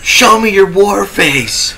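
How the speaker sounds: slow, high shouted drill order; drill sergeant. Retold or imitated. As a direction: bark it like a drill instructor. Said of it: "Oh, Full Metal Jacket".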